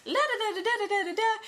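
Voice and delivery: high-pitched gushing imitation of herself; starstruck rambling. Fan meeting her idol at the airport, mock excited rambling. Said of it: "I just started rambling".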